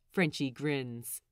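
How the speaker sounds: ordinary delivery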